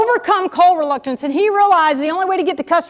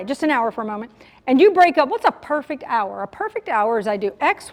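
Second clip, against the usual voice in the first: ordinary talk, nothing marked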